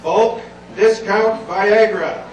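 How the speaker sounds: very slow, loud sales slogan; mock ad pitch. Reading embarrassing work emails to the crowd, loud ad pitch. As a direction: punch out each word like an advert. Reads out spam email subjects.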